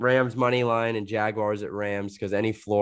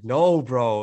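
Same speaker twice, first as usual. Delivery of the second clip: louder, raised cry of protest; mock dismay; 3 semitones higher, 5 dB louder than usual. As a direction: play up the dismay at ruined pants. Retold dismay at mucus on pants.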